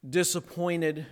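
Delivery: ordinary delivery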